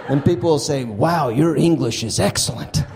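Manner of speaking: high, lively stage delivery for a laughing crowd; stand-up comedy set. Acted. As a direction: sell each punchline to the room. Stand-up set before interview.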